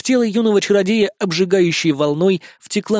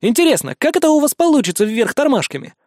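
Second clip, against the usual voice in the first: smirking; 6 semitones higher than usual. A narrator's reading. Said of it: "усмехнулся юный чародей".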